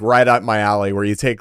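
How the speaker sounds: normal talking voice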